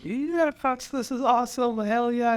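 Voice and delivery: mock yourself cheering your own idea; squeaky mock hype about his own work; giddy self-praise. Self-quote gloating over own idea.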